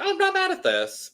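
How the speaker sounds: very high-pitched pleasant surprise; approving quoted reaction. Retold or imitated. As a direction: pitch it way up, pleasantly won over. Quoting own pleasantly surprised reaction.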